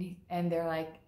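ordinary talk, nothing marked

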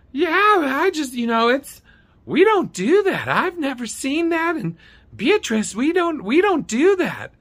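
high caricature brushing someone off; Dee's high-pitched voice. Retold or imitated. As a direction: imitate an unhelpful clerk in falsetto. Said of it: "She has this high-pitched voice".

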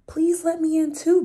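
high, pleading fox voice; begging fox. Acted. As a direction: beg sweetly to come in. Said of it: "begged Fox".

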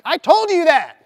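high and loud indignant snap; exasperated retort. Retold or imitated. Answering the bosses who just fired him, high and exasperated. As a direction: throw it back at them in disbelief.

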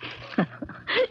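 a breathy, pleased little laugh; soft laugh. Acted. Niece laughs softly at her uncle calling her gal. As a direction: laugh softly, touched by the nickname.

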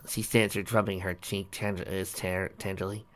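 ordinary delivery, nothing marked

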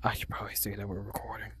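very soft, low whisper; hushed aside. Real, not acted. Player reminding himself about the recording, hushed aside.

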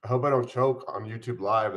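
usual speaking voice

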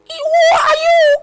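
high, loud, slow cry of the question; preaching cry. Real, not acted. Preacher crying out to the young lady, loud and high. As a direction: cry it out like a prophet.

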